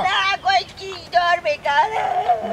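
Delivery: high, slow wailing words; stretched-out put-on crying. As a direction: whine the answer tearfully.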